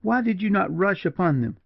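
high, frantic woman's demand; impassioned, pitch far up; wild reproach. A narrator's reading. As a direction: cry it out high and accusing. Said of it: "wildly catching him by the arm".

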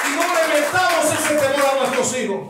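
high, loud sermon voice; triumphant pulpit shout; fiery preaching. Acted. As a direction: preach it with everything you have. Preaching at full force.

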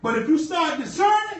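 calls it out to the church; preaching. Acted.

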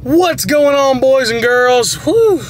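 upbeat vlogger intro; vlog greeting. Acted. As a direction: greet the viewers brightly.

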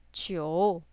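low dip on jiu, then up; falling-rising tone on jiu. Shown as a demonstration. Teacher models number nine for learners, falling-rising jiu. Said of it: "a falling rising tone".

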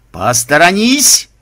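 loud, drawn-out call to clear the way; warning shout. Acted. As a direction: call out a slow, rising warning. Warning before a heavy swing.

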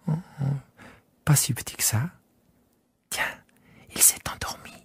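soft whisper so as not to wake the sheep; hushed whisper. Acted. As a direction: breathe it, the sheep is asleep. Prince whispers over sleeping sheep.

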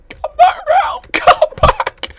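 high, loud falsetto wail, breaking into a laugh; hammed-up anguished cry for a lost friend; squealing mock grief. Wailing for lost partner.

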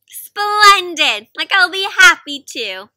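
loud, delighted helper; eager bunny. Acted. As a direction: burst out happy to help.

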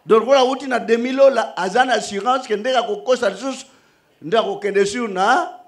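loud, fast, high preaching to the congregation; full-force preaching. Acted. Preacher addressing the brothers in Christ, loud preaching. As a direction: preach it out over the music.

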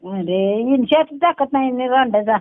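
usual speaking voice

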